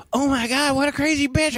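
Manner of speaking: high-pitched mock exclamation; imitated cart girl. Cart girl commiserating about rude customer, high imitated outrage. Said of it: "And she's all".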